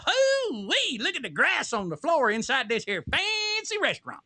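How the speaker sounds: high, twangy hillbilly exclamation. Pretend. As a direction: play the amazed country bumpkin. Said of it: "I'm gonna walk in and go".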